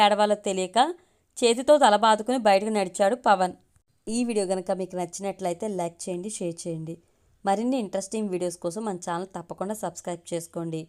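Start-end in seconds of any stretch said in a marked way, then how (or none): 4.1-10.8 soft, low subscribe request; channel outro. Acted. Narrator asks viewers to like and subscribe, softly. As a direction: read the outro quietly.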